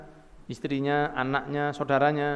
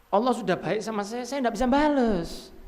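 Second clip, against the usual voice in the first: high-pitched acted lament, slowing at the end; tearful saint's plea; 7 semitones higher than usual. Preacher voicing saints weeping over God's kindness, tearful plea. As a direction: plead as if moved to tears, pitched up.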